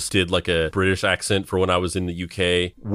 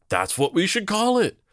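excited; 12 semitones higher than usual. Retold or imitated. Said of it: "they were like".

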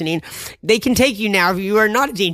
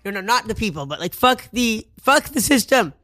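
ordinary delivery

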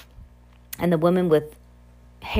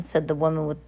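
usual speaking voice

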